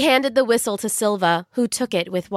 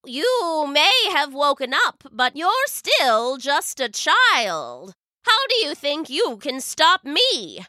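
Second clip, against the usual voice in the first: wicked witch voice; 6 semitones higher than usual. Acted. Witch taunting the girl.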